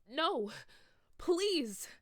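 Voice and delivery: loud, high desperate plea; loud, high frantic begging. A narrator's reading. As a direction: beg at the top of your voice. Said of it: "I begged".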